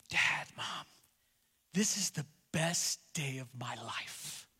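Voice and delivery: put-on soft whispered little-girl quote; hushed child's voice, barely voiced; daughter's voice. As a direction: breathe it like a thrilled four-year-old.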